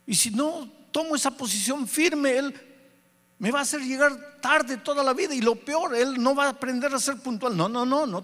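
high-pitched, insistent arguing; heated self-justification. Retold or imitated. Father defending his firm position to the Spirit, heated. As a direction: argue back high and firm, refusing to budge. Father argues back against inner voice.

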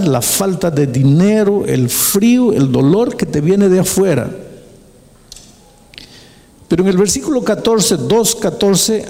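usual speaking voice

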